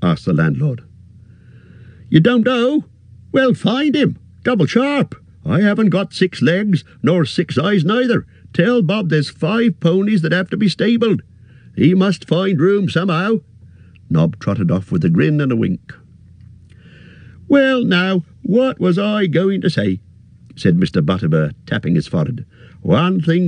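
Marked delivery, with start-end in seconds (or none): 2.1-13.4 high, brisk landlord giving orders; bustling orders. A narrator's reading. Innkeeper sends Nob after Bob, brisk and high. 17.5-20.0 much higher, bustling landlord voice; a fussy, friendly innkeeper bending down; cheery innkeeper. A narrator's reading. Innkeeper greets the hobbit, high and bustling.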